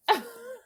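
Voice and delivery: short high-pitched laugh; a quick squeal of a laugh. Real, not acted. Laughing at her own joke in a squeal.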